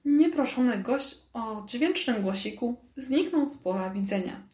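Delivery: plain reading voice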